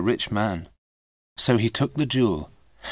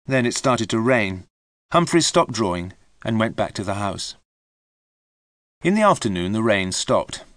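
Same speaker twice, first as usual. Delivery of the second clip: plain reading voice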